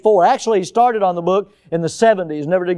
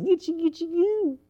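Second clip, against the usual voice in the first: put-on baby talk; 11 semitones higher than usual. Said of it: "All they knew was".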